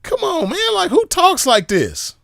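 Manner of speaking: very high, slow disbelief after a laugh; incredulous. Real, not acted. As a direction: squeak it out in mocking disbelief.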